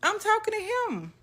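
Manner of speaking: put-on high, loud little-girl insistence; child insisting. Little girl answering her grandma, high and insistent. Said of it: "I said".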